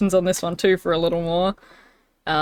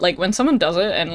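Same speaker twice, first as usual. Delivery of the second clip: usual speaking voice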